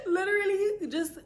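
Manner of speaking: real laughter breaking through, pitched high; laughing through words. Real, not acted. Telling viewers, laughing at her friend's dramatics. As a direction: giggle the words out.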